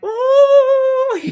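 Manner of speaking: high, loud "ooh" breaking into laughter; gleeful high hoot; excited whoop. Retold or imitated. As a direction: whoop with delight, then laugh. Reacting gleefully to the scene.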